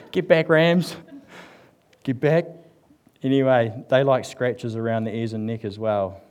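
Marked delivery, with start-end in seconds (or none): 0.1-2.5 a playful, hammed-up shout to shoo rams away; mock stern command. Pastor as shepherd shooing crowding rams back, mock stern shout. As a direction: bark it like a shepherd holding back pushy rams, half joking.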